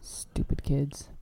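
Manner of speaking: slow whispered aside; whispered grumble. Real, not acted. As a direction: mutter it under your breath. Grumbling about coffee, unvoiced.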